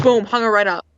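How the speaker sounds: ordinary delivery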